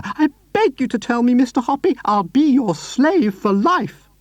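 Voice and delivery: high, loud begging cry; eager cry. A narrator's reading. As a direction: beg him to tell. Mrs Silver begging.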